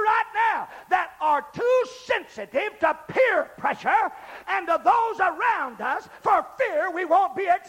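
high, impassioned revival holler; full-force preaching. Real, not acted. Preacher urging people past peer pressure, full-force holler.

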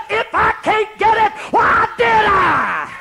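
high, loud, slowed defiant cry; triumphant retort. Retold or imitated. Wife answering the scolding vicar, high defiant shout. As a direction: fling the question back at him.

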